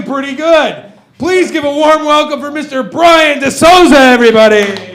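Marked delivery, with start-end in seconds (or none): 1.2-5.0 louder emcee call-up; club emcee shout. Acted. Host calls the next comic to the stage, loud call. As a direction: hype the room for the next act.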